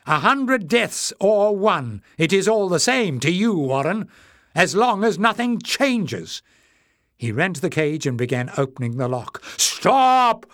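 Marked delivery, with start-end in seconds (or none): 0.1-6.4 high, loud, heated accusation; passionate shout. A narrator's reading. Clef accusing Warren before the crowd, passionate shout. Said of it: "shouted Clef passionately". 9.6-10.5 very high, loud command; enraged shout. A narrator's reading. Leader halting Clef at the cage lock, furious shout. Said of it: "shouted in rage".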